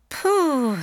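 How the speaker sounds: high, eager little elephant voice; elephant child. A narrator's reading. Elephant child answers the python in a high childlike voice.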